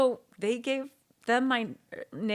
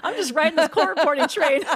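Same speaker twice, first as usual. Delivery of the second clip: laughing; 8 semitones higher, 8 dB louder than usual. Real, not acted. Laughing about own career.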